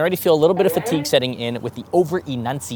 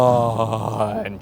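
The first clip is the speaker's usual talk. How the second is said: demo yawn; 3 semitones lower than usual. Shown as a demonstration. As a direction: open relaxed yawn. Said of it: "Just like that".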